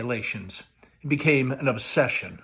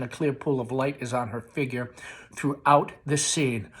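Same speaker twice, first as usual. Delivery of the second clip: ordinary delivery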